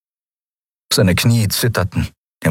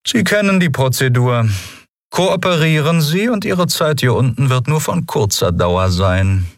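higher-pitched, measured official voice; cool interrogator; 7 semitones higher than usual. A narrator's reading. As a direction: state the procedure as a composed, formal commodore. Commodore interrogates his prisoner.

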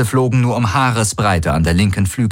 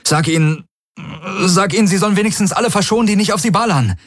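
rising higher with each repetition; desperate pleading; 10 semitones higher, faster than usual. Acted. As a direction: beg faster and faster. Galen begging, escalating.

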